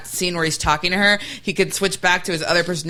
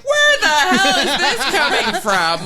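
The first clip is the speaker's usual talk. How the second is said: baffled surprise; 10 semitones higher, slower than usual. Retold or imitated. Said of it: "I was like".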